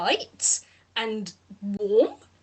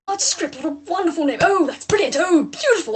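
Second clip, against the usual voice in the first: delighted praise; 5 semitones higher, 4 dB louder than usual. Acted. Lau delighted by name.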